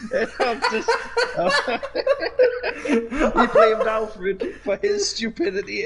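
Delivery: high, loud talking through laughter; cracking up while speaking; laughing hard. Real, not acted. Cracking up at retold scene.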